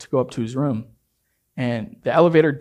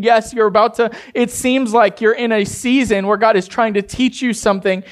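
higher, louder preacher's exhortation; preaching at full force; 6 semitones higher, 3 dB louder than usual. Real, not acted. Pastor urging the congregation, rousing preaching. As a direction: build it up as a rousing call.

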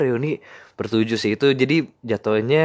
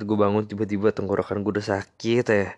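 ordinary talk

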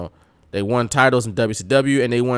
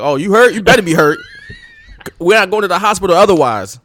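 louder, scolding mom voice; 4 semitones higher, 4 dB louder than usual. Retold or imitated. Mom scolding her hurt kid, mock stern. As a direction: scold a kid who claims he's hurt.

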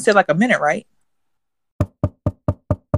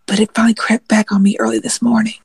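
normal talking voice

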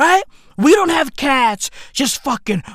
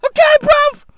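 much higher, loud squeaky okay bruv; high squeak; 7 dB louder than usual. Acted. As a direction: squeal the catchphrase. High catchphrase interjection.

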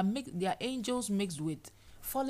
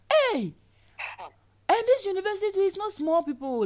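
shocked exclamation; 11 semitones higher, 9 dB louder than usual. Acted. Voiced shocked complainer.

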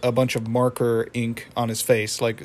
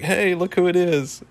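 higher-pitched naive, cheerful friend; Pops; 5 semitones higher than usual. Retold or imitated. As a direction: make light of it in a higher, innocent voice. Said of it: "just his usual naive self, laughs it off".

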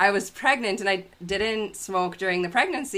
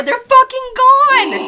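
loud high outraged cry, drawn out slowly; shocked yell; 11 dB louder, slower than usual. Retold or imitated. Mother finding her hidden cigarettes gone, shocked yell. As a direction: shout it like the stash just vanished. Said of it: "discovering cigarettes missing".

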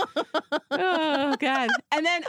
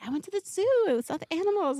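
put-on high, bright happy child voice; imitating a happy daughter; happy kid; 11 semitones higher, faster than usual. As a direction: chirp it like a cheerful toddler. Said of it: "and then happy, like".

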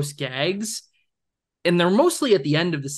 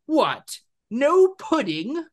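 higher, slow, stretched-out mock outrage; indignant blowhard husband; 10 semitones higher, much slower than usual. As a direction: play the pompous husband outraged there's no dessert. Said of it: "the husband again being like".

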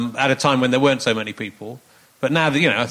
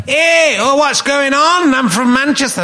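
loud, high-pitched attempt at Mancunian; hammed-up Manchester accent, calling out; overdone Mancunian, pitched way up; 11 semitones higher, 5 dB louder, slower than usual. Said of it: "I was just, uh, in Manchester".

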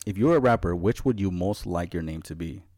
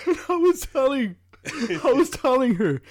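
laughing; 6 dB louder than usual. Real, not acted. High while laughing.

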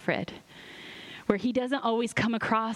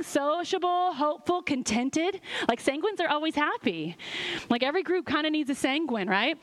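normal talking voice

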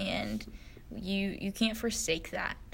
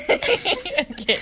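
okay through a high laugh; 14 semitones higher, 6 dB louder than usual. Real, not acted. Giving up the accent, laughing. Laughs at her failed attempt.

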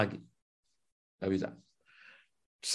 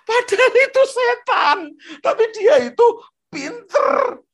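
much higher and louder, near-crying cry-out; high exclaim; 8 dB louder than usual. Real, not acted. Preacher warning the congregation about the clever devil, high cry.

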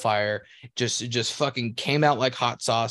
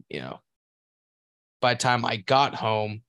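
normal talking voice

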